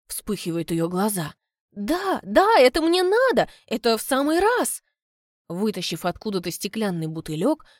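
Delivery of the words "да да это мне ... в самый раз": high, loud eager agreement; excited. Acted. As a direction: gush with delight, pitched up. Albina thrilled by potion.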